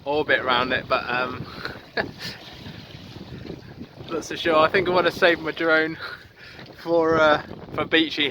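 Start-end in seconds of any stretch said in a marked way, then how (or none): 1.5-4.1 a short, soft, breathy laugh. Real, not acted. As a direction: let out a quiet laugh under the breath. Doubting plan to fly drone.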